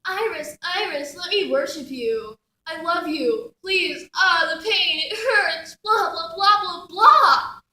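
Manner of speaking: worship plea building into loud blahs; mock his agony, rising to derisive babble; mocking pained priest. Goddess mimics her broken priest still worshipping, mock agony. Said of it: "he's out there moaning in pain".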